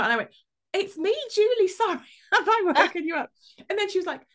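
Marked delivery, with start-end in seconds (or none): 0.7-3.3 high, loud, flustered phone voice; over-bright apology. Retold or imitated. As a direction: blurt it out with an embarrassed laugh. Embarrassed phone apology to woken caller.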